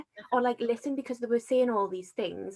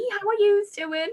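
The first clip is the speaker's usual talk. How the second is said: much higher, louder, sing-song chirp; chirpy deflection; 12 semitones higher, 9 dB louder than usual. Retold or imitated. [name] mimicking herself dodging her worries, high chirpy voice. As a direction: brush it off with a bright chirpy question. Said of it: "I'd be like".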